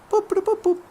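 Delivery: mock beeping. Imitating a radio signal.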